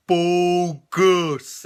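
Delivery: loud, drawn-out monster voice; imitated movie monster. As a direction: boom the word out, stretched. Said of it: "he's like".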